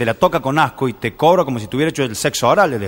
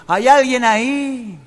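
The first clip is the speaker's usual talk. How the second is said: cave echo; 8 semitones higher, much slower than usual. Acted. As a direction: stretch it like a fading echo. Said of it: "y el eco".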